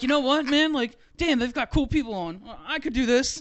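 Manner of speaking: sudden rush of enthusiasm; excited realization. Retold or imitated. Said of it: "I'm like".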